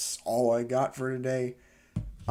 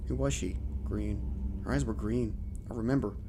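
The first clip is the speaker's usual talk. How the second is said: story narration; 4 dB quieter than usual. A narrator's reading. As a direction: read it like a spooky tale. Reads horror story aloud.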